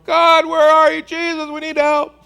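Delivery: high, loud pleading call; imagined disciples calling out in panic; desperate cry for help. Retold or imitated. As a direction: cry out to God in the storm. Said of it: "was looking around going".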